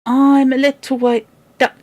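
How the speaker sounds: put-on high, sing-song little boy; lightly voiced toddler splashing about; happy toddler. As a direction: chirp it like a playful baby. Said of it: "said he".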